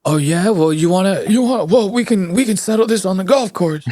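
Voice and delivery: lightly voiced indignant challenge; Biden. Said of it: "Biden's like".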